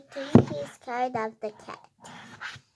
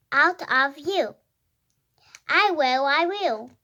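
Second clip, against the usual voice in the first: animated story reading; 6 dB louder than usual. Acted. Reader voicing a quarrelling cat and dog, animated read-aloud. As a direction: read it out clearly, pitch jumping up on woofs and meows.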